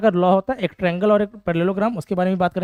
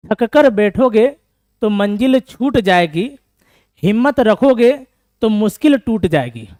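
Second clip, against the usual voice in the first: loud, slow declaiming with the fixed word छूट; slow, loud recital, now saying छूट जाएगी; corrected couplet recital; 3 semitones higher, 7 dB louder, slower than usual. Shown as a demonstration. Teacher re-recites the corrected couplet to the class, loud and slow. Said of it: "sorry, छूट जाएगी ना".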